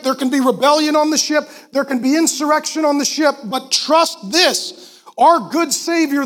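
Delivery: usual speaking voice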